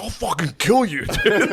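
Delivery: high-pitched mock threat; fully spazzed. Angry friend threatens the joker, high retold outburst. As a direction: imitate someone losing it, voice shooting up. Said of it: "he fully spazzed".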